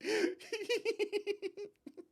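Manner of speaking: long, high tittering laugh; delighted little giggling fit; high giggle. Real, not acted. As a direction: giggle helplessly. Charmed by the character.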